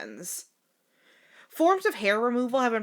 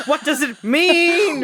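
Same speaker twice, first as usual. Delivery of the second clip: laughing; 6 semitones higher, 8 dB louder, slower than usual. Pretend. Mock dramatic question.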